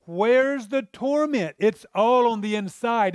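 normal talking voice

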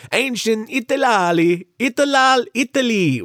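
high, slow, tongue-tangled mock Italian; botched Italian accent. Host announces today's trip to ancient Rome, botched Italian accent. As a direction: stumble over Italy in a hammed-up Italian accent.

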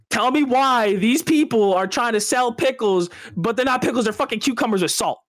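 high-pitched, exasperated griping; pissed-off rant. Real, not acted. Man griping to friends about bad diner pickles, exasperated. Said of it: "you know what pisses me off".

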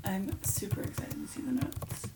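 playful hushed whisper. Real, not acted. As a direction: whisper it teasingly. Teasing aside while friend searches.

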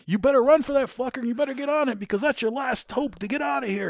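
very high, insistent imitation yell; retold high-pitched sergeant threat; threatening drill sergeant.

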